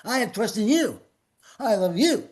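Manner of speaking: a hint of a tender Jesus reassuring you; Jesus speaking kindly to the believer, mildly voiced; voicing Jesus. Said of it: "Jesus looked at me and said".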